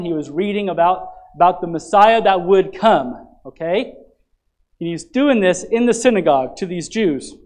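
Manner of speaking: loud, rising sermon delivery, pressing each line home; full-force preaching. Acted. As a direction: proclaim it to the congregation.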